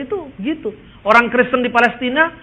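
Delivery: ordinary delivery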